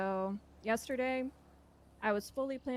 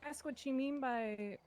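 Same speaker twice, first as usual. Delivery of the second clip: supervillain voice; 3 semitones higher, 3 dB quieter, slower than usual. Real, not acted. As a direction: drag it out like a cartoon supervillain. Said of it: "Why are you talking like a supervillain?".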